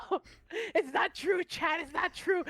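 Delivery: high, loud playful protest; hammed-up squeal of denial; mock denial. Playfully denying being exposed to chat.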